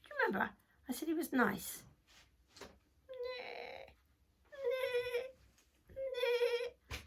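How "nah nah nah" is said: high whinnying horse; imitation of a horse; horse neigh. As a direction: neigh the word out. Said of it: "the horse came along".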